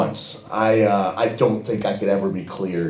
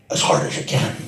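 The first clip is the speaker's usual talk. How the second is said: Irish brogue. Retold or imitated. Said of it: "he grabs me and goes".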